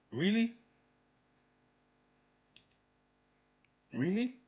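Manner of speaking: exaggerated sarcastic double-take; sarcastic disbelief. Real, not acted.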